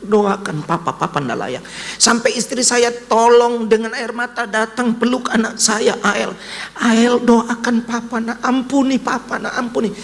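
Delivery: high, pleading voice begging forgiveness; tearful pleading. Retold or imitated. Father kneeling, begging his son's forgiveness, tearful plea. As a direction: plead with your son, close to tears. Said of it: "Saya bilang".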